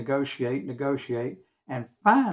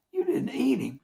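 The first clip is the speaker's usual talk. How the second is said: appalled; 10 semitones higher than usual. Retold or imitated. Pop appalled at Mutt.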